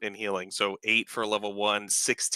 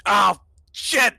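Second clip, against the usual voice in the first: high, loud startled yelp; panicked curse; 11 semitones higher, 9 dB louder than usual. Acted. Lex is blown back by shockwaves, a panicked high yelp. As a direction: yelp it as you bolt. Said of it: "He says".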